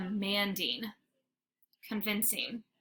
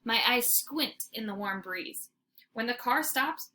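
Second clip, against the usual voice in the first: usual speaking voice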